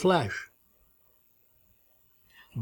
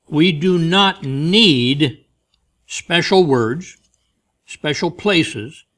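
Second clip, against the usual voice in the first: each phrase slow, louder and higher, spaced out; slow emphatic preaching; 4 semitones higher, 5 dB louder, much slower than usual. Acted. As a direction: lay each word down with weight. Preacher hammers key phrase.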